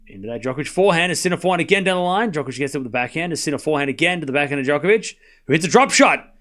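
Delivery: ordinary talk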